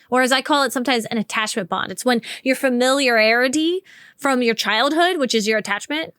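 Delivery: ordinary delivery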